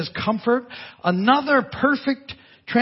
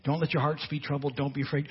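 softer, lower, unweighted run-through; casual, low-key recital; 5 semitones lower, 8 dB quieter, faster than usual. Retold or imitated. Imitating listeners who nod at Jesus' words, casual and soft. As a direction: say it lightly, as nice words in passing. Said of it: "you're like, Oh, that's good, right?".

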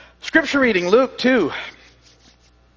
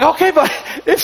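laughing; 12 semitones higher, 6 dB louder than usual. Real, not acted. Laughing while retelling.

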